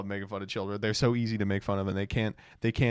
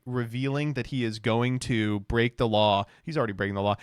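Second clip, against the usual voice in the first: ordinary delivery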